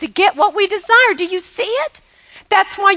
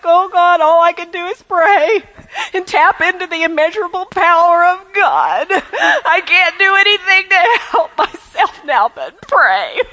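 whiny, helpless mock prayer; mock pitiful prayer. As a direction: pretend to be a pathetic pauper begging God. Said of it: "like we're so pitiful and so pathetic".

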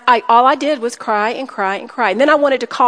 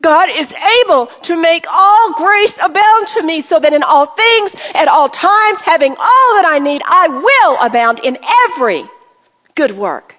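raised and louder, declaring each line; forcing out the truth; 5 semitones higher, 5 dB louder than usual. Shown as a demonstration. Preacher to women, declaring scripture through her terror, loud. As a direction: proclaim it as if fighting off fear. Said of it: "I make myself say the truth of God".

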